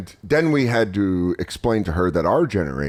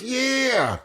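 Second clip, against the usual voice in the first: dad's yeah; 12 semitones higher, 7 dB quieter than usual. Retold or imitated. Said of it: "He goes".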